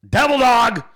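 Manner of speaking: loud, high call-out; shouted shout-out. Real, not acted. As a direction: yell the name out proudly. Shout-out calling name loudly.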